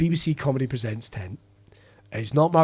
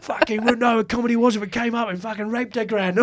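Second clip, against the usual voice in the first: imitating a gruff agent; 8 semitones higher than usual. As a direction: rant like an old-school cockney agent. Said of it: "he'd be like".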